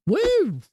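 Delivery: high-pitched "woo"; excited whoop. Real, not acted. Streamer hyping the big speedrun, high whoop.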